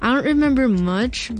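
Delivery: usual speaking voice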